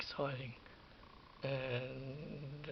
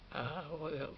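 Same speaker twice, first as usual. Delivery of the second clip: normal talking voice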